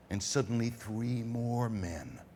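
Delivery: usual speaking voice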